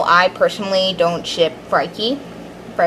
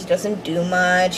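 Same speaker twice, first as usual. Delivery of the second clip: ordinary delivery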